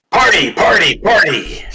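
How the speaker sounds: high, loud chant, each word hit hard; beast shouting. Acted. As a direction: shout it like a beast cheering on a frenzy. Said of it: "shouting in your head".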